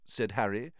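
plain reading voice

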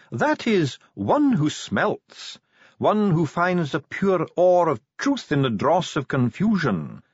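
high, ceremonious dwarf voice; lofty definition, pitched high; dwarf spokesman explaining. Acted. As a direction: spell it out grandly.

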